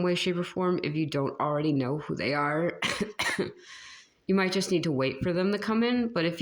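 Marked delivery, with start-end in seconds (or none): 2.8-3.5 sudden dry cough; coughing fit. Real, not acted. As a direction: cough hard mid-sentence. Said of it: "sorry for the coughing".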